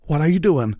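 loud, high desperate cry; desperate shout. A narrator's reading. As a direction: shout it in alarm. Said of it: "Applejack sought desperately".

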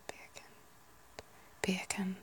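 quiet, tentative Northern Irish try; Northern Irish accent. Shown as a demonstration. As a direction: test a Northern Irish accent under your breath. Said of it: "I feel like Northern Irish".

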